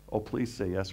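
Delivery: normal talking voice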